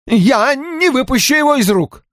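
high-pitched protest; agitated, high and defiant; indignant refusal. A narrator's reading. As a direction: refuse hotly, voice shooting up. O'Neill refuses to give up briefcase.

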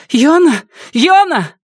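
high, loud desperate calling. A narrator's reading. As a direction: call his name across the arena. Лаура зовёт раненого Йону. (отчаянный крик)

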